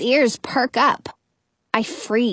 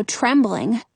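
plain reading voice

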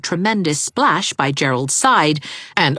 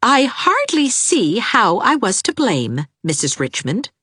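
cold; 8 semitones higher than usual. A narrator's reading. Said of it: "eyes as cold as grey mist".

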